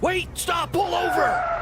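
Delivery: high yelled command; urgent shout. Real, not acted. Promoter shouts at Buzz to pull the car over. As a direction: yell for the car to stop now.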